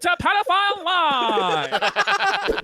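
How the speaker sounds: slow, drawn-out announcer voice; hammed-up TV announcer; mock show host.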